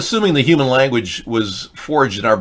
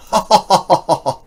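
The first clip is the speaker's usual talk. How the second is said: hammy high laugh; 9 semitones higher, 4 dB louder than usual. Acted. Character laugh.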